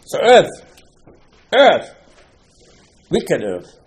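high loud call of earth; dramatic exclaim. Acted. Preacher building the drama of the earth's trick, high and loud. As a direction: cry out the word earth.

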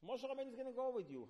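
high, loud rousing cry; rally them with a high call; preacher lifting the room; excited exclaim. Real, not acted.

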